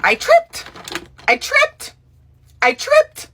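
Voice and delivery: very high, eerie repeated words with a rise at the end; a creepy sleepwalker repeating himself. Retold or imitated. Sleepwalking husband explaining the grab to his wife, an eerie high creepy voice. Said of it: "in the creepiest tone ever".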